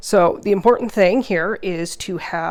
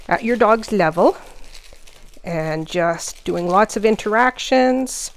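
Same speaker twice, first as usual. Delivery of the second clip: ordinary talk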